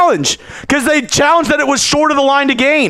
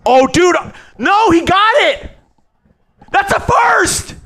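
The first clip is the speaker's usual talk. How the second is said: excited shout; 6 semitones higher than usual. Real, not acted. Excited reaction to first down.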